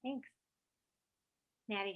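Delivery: ordinary delivery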